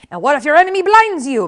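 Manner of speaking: loud, high challenging teacher; MacPherson. A narrator's reading. Sword master MacPherson tests Inigo, loud challenge. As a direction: throw the question hard at your pupil.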